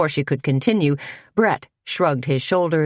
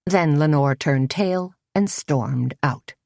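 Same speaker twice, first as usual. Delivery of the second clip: plain reading voice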